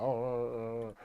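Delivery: mocking idiot stammer. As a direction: imitate someone groping for words. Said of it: "dumb fucks like this talking about".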